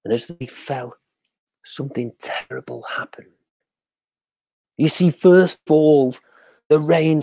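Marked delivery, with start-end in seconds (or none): 1.6-3.3 ominous hushed storyteller. A narrator's reading. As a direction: drop to a hush. Storyteller building dread.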